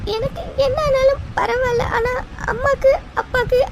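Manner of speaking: high, broken sobbing with halting pauses; crying. Acted. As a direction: plead through tears, frightened for the family. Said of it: "இன்னமும் எதுக்கு அபி அழுதுட்டு இருக்க". (விம்மி அழுதுகொண்டே)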